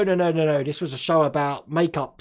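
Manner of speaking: ordinary talk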